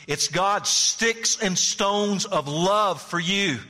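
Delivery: P=A; slow emphatic, high. Acted. Repeats the line to the hearers.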